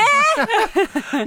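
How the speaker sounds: sudden high laugh; high burst of laughter. Real, not acted. Laughing at joke.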